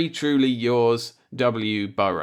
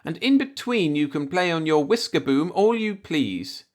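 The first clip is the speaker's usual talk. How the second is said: a motherly landlady laying down the law, higher; Mrs. Burrows firm; 7 semitones higher than usual. A narrator's reading. Landlady telling Jack the rules, motherly firm. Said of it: "Missus Burrows told him".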